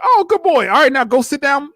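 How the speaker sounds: put-on high, loud baby-talk to a dog; doting dog-owner voice. Bride praising the ring-bearer dog, high and cooing. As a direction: coo at the dog like a proud owner. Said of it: "she's like".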